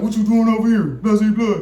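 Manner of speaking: loud, high cop voice; mock cop question. Cop questioning them: loud high voice. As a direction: bark it out like the cop. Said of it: "He was like".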